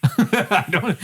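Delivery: ordinary talk, nothing marked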